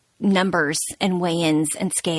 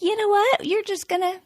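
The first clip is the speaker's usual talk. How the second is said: much higher, gentle loving voice of God; God's tender voice; 5 semitones higher than usual. Retold or imitated. God reassuring his weary daughter, tender and high. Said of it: "And he was like".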